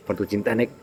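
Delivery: soft reassurance. Acted. Husband soothes wife.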